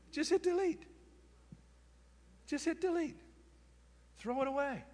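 tiny, high-pitched little urging; hushed squeak of advice; high soft voice. Shown as a demonstration. Comic repeated advice, squeaked softly.

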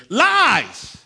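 high, loud cry of "Lies!"; shouted lies. Real, not acted. Preacher denouncing many ways to heaven, shouting. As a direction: throw the word at the crowd.

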